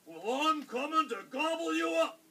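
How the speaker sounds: high, loud troll threat; roaring troll. A narrator's reading. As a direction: roar a threat as the hungry troll. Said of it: "roared the troll".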